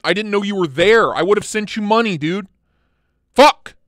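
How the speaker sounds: frustration building to a loud curse; pissed off. Real, not acted. Streamer learns he missed buying a Malice, pissed off. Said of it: "I'm pissed off now".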